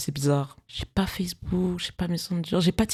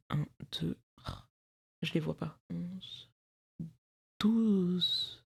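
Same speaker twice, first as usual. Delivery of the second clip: soft, far-off counting while searching; quiet counting while looking around the room; counting under breath; 8 dB quieter, much slower than usual. Real, not acted. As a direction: murmur the count to yourself. Counting plush frogs under breath.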